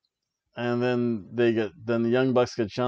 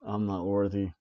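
mock bowing; 4 semitones lower, 7 dB quieter than usual. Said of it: "I've been humbled".

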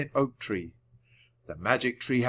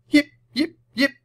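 very high, loud little dog yelps; puppy yips; 7 dB louder than usual. A narrator's reading. Scruffy puppy answers as the ball flies, yipping. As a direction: yip like a small excited puppy.

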